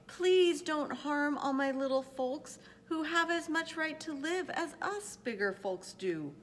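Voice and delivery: high, loud desperate plea; urgent storybook begging, voice held high. A narrator's reading. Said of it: "and begged".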